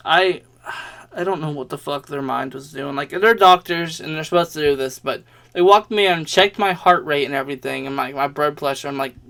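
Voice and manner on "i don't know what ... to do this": ordinary talk, nothing marked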